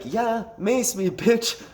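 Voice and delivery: high-pitched mock goading; imitated cocky challenge; retold taunting dare. Retold drunk daring to be maced.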